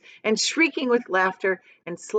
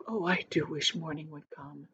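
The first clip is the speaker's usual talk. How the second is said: soft, shivering little plea; cold and scared, barely voiced; 3 semitones lower, 10 dB quieter than usual. A narrator's reading. Miss Spider shivering in the dark night. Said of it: "shivering all over".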